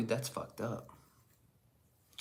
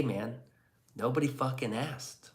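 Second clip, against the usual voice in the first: ordinary talk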